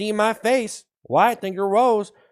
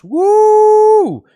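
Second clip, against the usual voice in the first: excited cheer; 12 dB louder than usual. Acted. Hype cheer.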